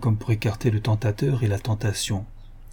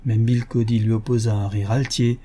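usual speaking voice

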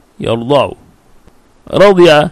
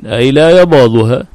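ordinary talk, nothing marked

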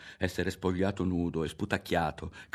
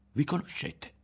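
higher-pitched, puzzled question; taken aback; 8 semitones higher than usual. A narrator's reading. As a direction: ask in astonishment. Berto, stunned, asks.

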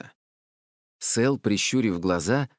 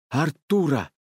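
raised, firm insistence; insistent; 6 semitones higher, 3 dB louder than usual. A narrator's reading. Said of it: "настаивал Сэл". (настойчиво)

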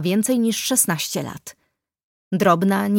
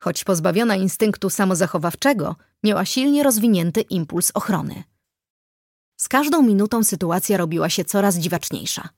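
ordinary delivery

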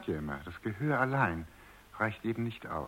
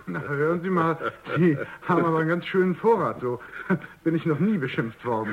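loud, higher, amused exclamation; amused outburst; 7 semitones higher, 7 dB louder than usual. Acted. Boss teasing the butler after his insults, loud and amused. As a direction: still tickled by the insults, say it loud.

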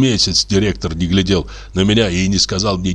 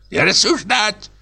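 put-on hoarse old man; 13 semitones higher than usual. Old director rebuking the lodger, croaky old man. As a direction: bark it like a tetchy old man. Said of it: "крикнул старческий голосок".

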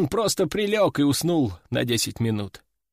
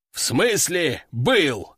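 usual speaking voice

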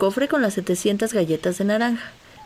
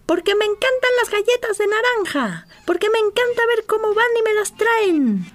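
shouting from afar; 12 semitones higher than usual. Acted. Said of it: "gritó desde lejos".

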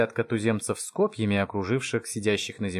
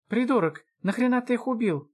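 high-pitched exasperated elf; outraged; 13 semitones higher than usual. Acted. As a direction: scold your would-be rescuer. Эльф ругает спасителя. (возмущённо, высоким голосом)

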